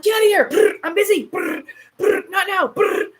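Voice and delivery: high, loud shouts between gunfire noises; hammed-up busy gamer under attack; frantic mock yelling. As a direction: yell him away while firing. Retells snapping at ex while shooting.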